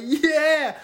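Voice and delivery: loud, high boastful yeah; cocky yeah. Retold or imitated. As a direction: brag it out. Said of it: "Hush Puppy like".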